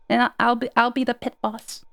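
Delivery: ordinary delivery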